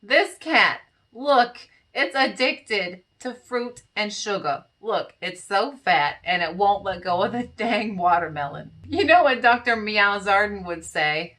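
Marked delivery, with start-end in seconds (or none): none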